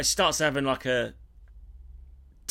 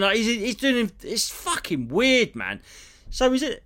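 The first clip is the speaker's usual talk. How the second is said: ordinary delivery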